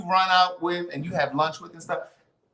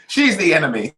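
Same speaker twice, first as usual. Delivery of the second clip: laughing out the line; 3 dB louder than usual. Real, not acted. Laughing at own story.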